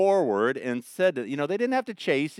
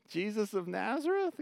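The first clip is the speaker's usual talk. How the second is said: unsure, higher and softer, rising like a question; less conviction; 7 semitones higher, 7 dB quieter than usual. Shown as a demonstration. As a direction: say the name as if no longer sure of it. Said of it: "with a little less conviction".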